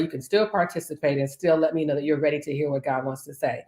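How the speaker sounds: ordinary talk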